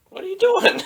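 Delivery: squeaky incredulous question; mock bewildered protest; high-voiced bafflement. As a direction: pitch the question way up in disbelief.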